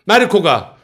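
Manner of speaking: ordinary delivery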